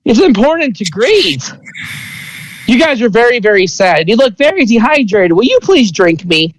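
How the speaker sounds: lightly voiced little creature. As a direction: coax them gently as a tiny creature. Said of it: "And it's like".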